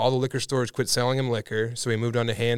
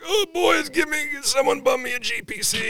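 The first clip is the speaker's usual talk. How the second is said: much higher, whiny mock voice; whiny drunk Carl. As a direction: whine like a beaten drunk. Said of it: "Carl would be like".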